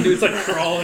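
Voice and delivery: ordinary talk, nothing marked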